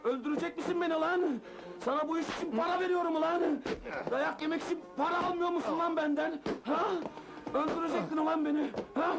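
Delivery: high-pitched, raging tirade; furious scolding. Acted. Boss hitting late Mazlum, furious. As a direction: rant wildly between blows.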